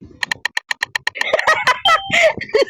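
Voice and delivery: very high, squealing laugh fit; long high-pitched shriek of laughter. Real, not acted. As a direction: laugh helplessly out loud. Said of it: "sorry sa tawa ko".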